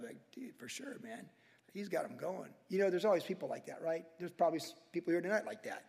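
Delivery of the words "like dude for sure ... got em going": quiet mock onlooker. Onlookers quoted.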